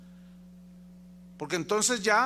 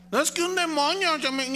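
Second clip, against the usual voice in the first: higher whining excuse voice; mock excuse; 9 semitones higher, 6 dB louder than usual. Preacher mimicking a believer blaming the devil, whining. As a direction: whine that the demon made you.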